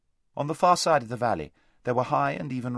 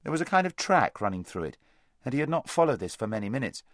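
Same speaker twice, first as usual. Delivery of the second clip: plain reading voice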